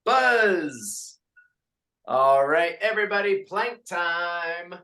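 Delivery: upbeat class count, sung out; fitness instructor calls. Acted. Instructor counting reps for the class. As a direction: lead the class through reps.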